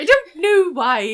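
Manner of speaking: high, loud mock British; British accent. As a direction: ham up a silly British accent. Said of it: "say this in a British accent".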